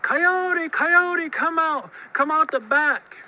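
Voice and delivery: high, loud begging calls; desperate pleading. A narrator's reading. Monster begs Coyote to come out, desperate. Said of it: "he was getting desperate".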